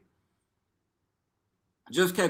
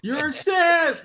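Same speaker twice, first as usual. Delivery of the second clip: mock taunt; 8 dB louder, slower than usual. Mocking taunt, laughing.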